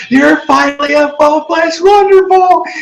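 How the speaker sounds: high, squeaky excited filly voice; excited Scootaloo. Retold or imitated. Scootaloo cheering Dash, high and excited. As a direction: shriek it like an excited young fan.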